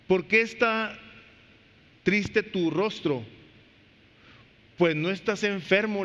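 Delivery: the king asks Nehemiah, read slowly; scripture reading. Acted. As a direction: read the question out slowly and clearly. Reading the king's question.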